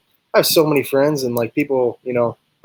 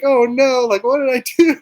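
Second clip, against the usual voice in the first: mock panicked girlfriend; 9 semitones higher than usual. Fiancée panics after proposal.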